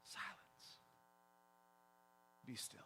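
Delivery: very soft, hushed command; near-silent command. Acted. Jesus stands up to still the storm, hushed command. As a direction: barely voice it, spacing the words apart. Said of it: "all he says is a couple silent words".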